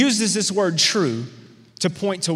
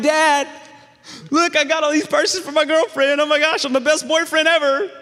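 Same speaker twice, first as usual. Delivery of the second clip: much higher and louder boast; proud excited teen; 10 semitones higher, 3 dB louder than usual. Retold or imitated. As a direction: show off to dad like a kid. Proud teen shows dad purses.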